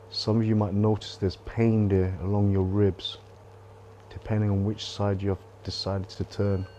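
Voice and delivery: quiet guide fading into stillness; hushed guided meditation. Acted. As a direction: ease them into letting go, softly.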